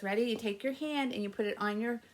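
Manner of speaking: ordinary delivery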